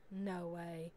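normal talking voice

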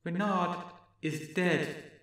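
high, soft, slow faraway voice; strange distant voice. Acted. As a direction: speak slowly from the depths. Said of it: "the voice went on".

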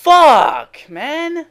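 high, loud curse; frustrated outburst. Real, not acted. Gamer cursing a lost match, frustrated.

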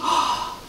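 soft, audible gasp; story gasp. A narrator's reading. Teacher reacting as animals hear Blue, soft gasp. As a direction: draw in a quick surprised breath. Animals hearing Blue's call.

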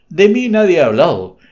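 acrid disdain. A narrator's reading. Joaquín answers the cynic Federico with bitter disdain. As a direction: spit it out with sour contempt. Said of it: "con acre displicencia".

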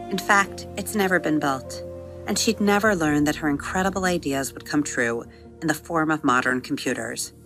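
ordinary delivery, nothing marked

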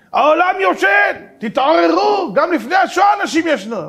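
high, loud, slow-starting warning cry; frantic philosopher yelling the world is asleep; alarmed shout. Retold or imitated. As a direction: shout them awake.

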